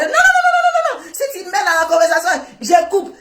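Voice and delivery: mock refusal in a very high, squeaky voice. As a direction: squeal the nos like a panicked man. Mocking his refusal on the phone. (refus moqueur, voix très aiguë et criarde)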